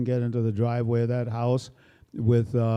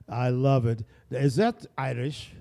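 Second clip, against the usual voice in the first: ordinary talk, nothing marked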